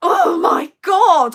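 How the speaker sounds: loud, drawn-out cry of exasperation; exasperated outburst. Real, not acted. Parent venting about a maddening phone call, exasperated outburst. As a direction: let it burst out high, slow and loud.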